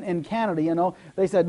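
ordinary talk

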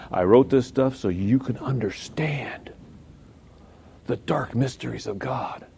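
slow hushed whisper of awe. Shown as a demonstration. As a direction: breathe it slowly as a wonder. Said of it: "I said it in a whispered voice".

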